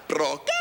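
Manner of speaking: "Broca." said low with a rolled B-R start, relished; savouring a word. Shown as a demonstration. To the crowd, relishing his second favourite word: low and savoured. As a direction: roll "Broca." round the mouth slowly. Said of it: "I just really love saying it".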